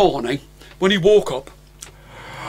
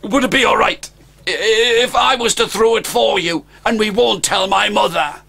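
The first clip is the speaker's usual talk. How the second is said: put-on higher, pleading child's voice; a dragon child begging a stranger; pleading dragon boy; 4 semitones higher than usual. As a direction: beg him not to throw it.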